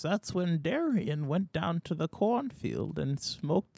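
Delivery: put-on high, soft, slow old man reminiscing; very old grandpa voice, wandering story; rambling ancient old man. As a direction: drift off into an old memory, doddering.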